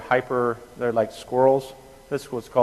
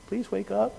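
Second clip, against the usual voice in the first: higher, softer mock "Please wake up"; timid polite plea; 10 semitones higher, 5 dB quieter than usual. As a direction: imitate a meek nurse gently asking. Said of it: "normally speaking to people and going".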